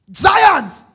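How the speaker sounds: high, loud exclaim; emphatic giants. Acted. Preacher stressing the giants to the congregation, loud high. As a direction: throw the word out big.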